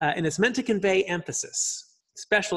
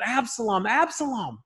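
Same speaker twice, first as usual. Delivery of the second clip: mock anguished yell; 8 semitones higher than usual. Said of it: "David yelling out".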